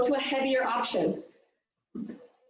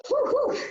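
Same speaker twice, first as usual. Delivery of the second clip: sharp high puffs of breath, winded; two quick winded exhales; 13 semitones higher than usual. Real, not acted. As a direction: blow out hard like after burpees. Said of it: "Man, I'm already out of breath".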